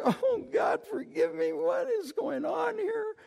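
very high, wailing plea; mock desperate plea. Preacher replays begging God for forgiveness, high mock wail. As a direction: cry out high in hammed-up dismay.

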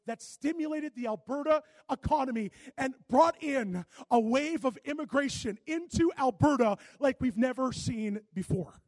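loud, rising sermon build; preaching at full force. Acted. Pastor preaching to his church, loud and rising. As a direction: drive it home to the congregation.

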